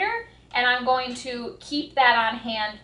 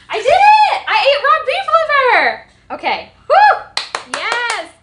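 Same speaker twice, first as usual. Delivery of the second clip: much higher, much louder, cheering; triumphant shout; 13 semitones higher, 11 dB louder, slower than usual. Real, not acted. Vlogger celebrating swallowing raw liver, triumphant shout. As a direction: whoop in victory.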